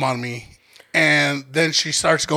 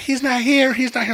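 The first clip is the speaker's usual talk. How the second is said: higher-pitched imitation of a flustered woman; retold frantic denial; 8 semitones higher than usual. Woman at the door to intruders, high frantic denial. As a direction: repeat it in a raised, pleading pitch.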